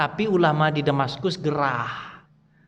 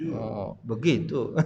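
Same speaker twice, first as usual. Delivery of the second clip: timid reply; 7 semitones lower, 8 dB quieter, much slower than usual. Pretend. Listener's timid reply acted.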